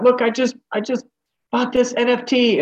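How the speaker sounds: high, eager bragging voice; excited boaster. Retold or imitated. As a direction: show off a purchase proudly. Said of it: "somebody was like".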